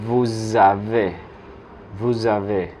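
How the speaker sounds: "Vous avez" linked with a buzzing Z, vou-zavez; Z liaison. Shown as a demonstration. French teacher showing Spanish speakers usted tiene, voiced Z liaison. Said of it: "suena es como una Z".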